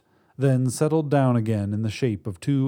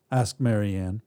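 ordinary delivery, nothing marked

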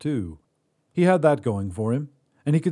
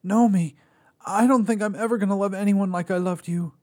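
higher, raw longing; heartbroken pleading man; 10 semitones higher, 4 dB louder than usual. A narrator's reading. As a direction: confess it with everything left. Joel confesses his love.

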